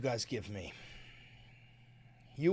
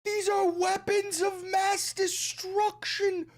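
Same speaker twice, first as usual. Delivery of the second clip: mocking echo; slower than usual. Mockingly echoes clip phrase.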